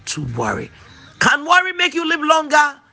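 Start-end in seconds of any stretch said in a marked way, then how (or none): 1.2-2.8 high. Real, not acted. Preacher's rhetorical exclamation.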